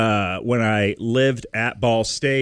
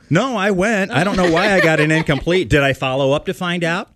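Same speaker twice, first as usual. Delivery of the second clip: laughing; 6 semitones higher, 5 dB louder than usual. Real, not acted. Laughing at own gym story.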